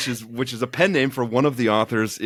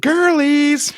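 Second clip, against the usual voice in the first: high, loud drawn-out squeal; over-the-top high girly shout; mock girly call; 7 dB louder than usual. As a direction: squeal it out, stretched long. Playfully echoing her hey girlies call.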